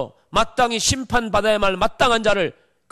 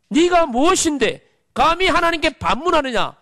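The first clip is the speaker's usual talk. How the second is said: 네가 hit higher and louder, rising rebuke; forceful preaching rebuke; 6 semitones higher, 4 dB louder than usual. Acted. Preacher rebuking the congregation with the verse, forceful and louder. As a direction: thunder the verse back at the doubter.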